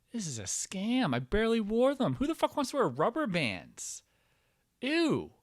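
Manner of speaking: high, indignant disbelief; rising outrage about the scam; exasperated rant. Retold or imitated. Retold rant at the orthodontist.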